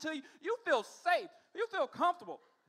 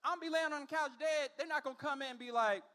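ordinary talk, nothing marked

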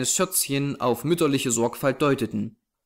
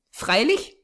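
put-on high, prompt child's answer; little girl; 9 semitones higher than usual. A narrator's reading. Small girl answering her companion's question, artless.

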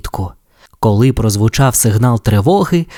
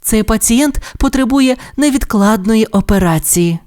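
high, polite nurse; 10 semitones higher than usual. Acted. Nurse addresses the doctor, high and polite. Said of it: "медсестричка".

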